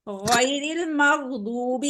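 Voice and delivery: गैरिल मग़दूबी stretched very slowly, again held still; slow model reading. Shown as a demonstration. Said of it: "again साकिन है". (धीरे-धीरे, again को बिना हिलाए)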